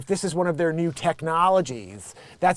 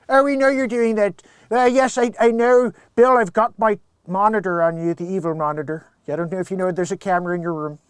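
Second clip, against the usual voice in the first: Doctor Evil; 4 dB louder than usual. Pretend. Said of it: "Doctor Evil".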